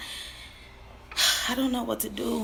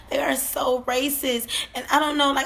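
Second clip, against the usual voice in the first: ordinary talk, nothing marked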